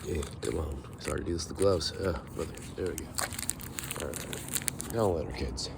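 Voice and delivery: normal talking voice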